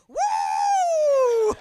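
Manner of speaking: long, piercingly high cheer; imitated crowd whoop. Men in the bar backing her, imitated loud high cheer. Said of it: "and they're like".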